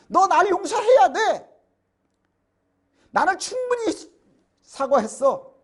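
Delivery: mock-indignant insistence that the other side must forgive; demanding, entitled. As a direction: play the offender who insists he has apologized enough. Voicing an unrepentant offender.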